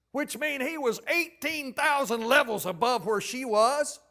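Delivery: loud, high sermon delivery; impassioned preaching. Real, not acted.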